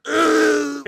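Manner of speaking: loud, high strained groan; mock climax groan. Acts the man set off.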